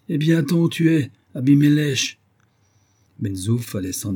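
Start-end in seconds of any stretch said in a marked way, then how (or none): 0.1-2.2 curt dismissal. Acted. Benzouf dismisses Isaac curtly.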